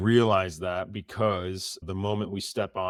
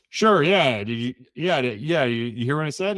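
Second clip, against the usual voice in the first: high, louder, tripping over the words; nervous babbling to a buddy; flustered stammer; 5 semitones higher, 6 dB louder than usual. Retold or imitated. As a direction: stumble through it, rattled and unsure. Flustered, doubting himself aloud.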